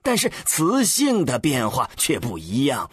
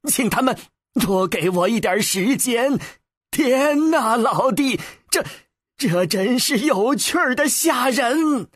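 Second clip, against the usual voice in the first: higher-pitched, marvelling character voice; excited amateur linguist; eager scholar, thrilled; 7 semitones higher than usual. A narrator's reading. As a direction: report back, then burst into wonder.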